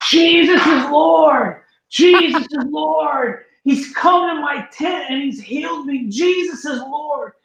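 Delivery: high, slow, loud shouting praise; joyful shout. Retold or imitated. Healed man shouting his praise down the street.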